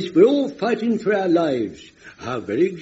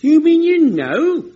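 high, loud and slow in sudden shock; stunned the old man already knows; shocked disbelief; 12 semitones higher, 7 dB louder, slower than usual. Acted. Jones, realising Godfrey already knows, in shocked disbelief.

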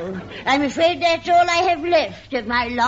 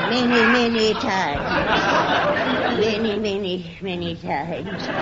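drawn-out innuendo; 2 semitones lower than usual. Acted. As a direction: stretch each many out slowly and suggestively.

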